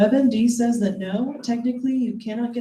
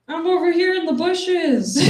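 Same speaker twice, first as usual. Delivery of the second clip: put-on higher, louder little kid calling out; little girl voice; 8 semitones higher, 5 dB louder than usual. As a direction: call out like a child who doesn't get the game. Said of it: "she's like".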